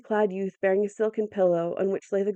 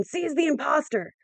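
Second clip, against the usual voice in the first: higher, urgent order; commanding cry; 7 semitones higher than usual. A narrator's reading. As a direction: order the guards to seize him. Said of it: "he screamed".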